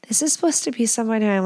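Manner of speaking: ordinary delivery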